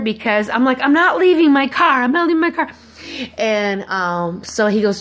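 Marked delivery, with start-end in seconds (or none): 0.8-2.7 high, insistent retold protest; acted-out stubborn outburst; adamant refusal. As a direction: dig in stubbornly, pitch raised. Retold refusal to leave her car.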